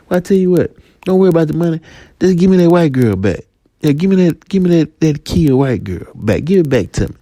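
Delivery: high, insistent demand; dealer. Acted. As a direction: push him to hand it back. Dealer demands cocaine back.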